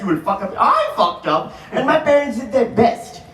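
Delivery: ordinary delivery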